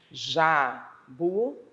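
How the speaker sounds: jabuti bem devagar, ja-bu-ti; separado em sílabas. Shown as a demonstration. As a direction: marque cada sílaba de jabuti. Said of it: "eu precisei abrir a boca três vezes". (jabuti bem pausado, sílaba por sílaba)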